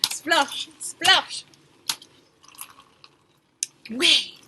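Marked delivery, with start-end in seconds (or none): 0.0-1.4 loud, playful splosh calls; splashy sound words. A narrator's reading. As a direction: stamp out each splosh loudly. River sound words acted out.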